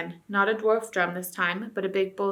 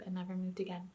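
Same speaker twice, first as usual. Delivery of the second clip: usual speaking voice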